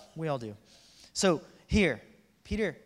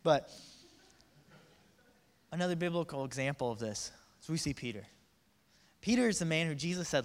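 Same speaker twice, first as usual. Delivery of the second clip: ordinary talk, nothing marked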